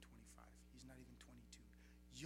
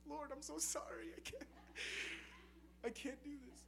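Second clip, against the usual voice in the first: higher, pleading I can't; pleading apology; 11 semitones higher than usual. Retold or imitated. Young man pleading with the Lord, mild tearful plea.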